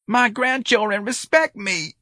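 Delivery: put-on slow, high and tearful old man. A narrator's reading. Old man pleads with the sergeant, slow and tearful. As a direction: hold back tears with pride. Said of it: "Mister Robichaud was in tears".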